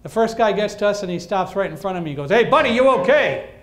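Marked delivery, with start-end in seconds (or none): 2.3-3.5 loud, high shout at a blind man; imitating a firefighter shouting at a blind man. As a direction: bellow it as if he can't hear. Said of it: "He's shouting".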